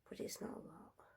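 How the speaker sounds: a spooky storyteller's whisper; eerie whisper. A narrator's reading. Narrator whispering the dark room to listeners. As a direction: breathe it out as a hushed whisper.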